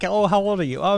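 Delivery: ordinary talk, nothing marked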